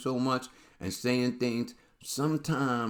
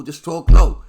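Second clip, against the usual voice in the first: ordinary delivery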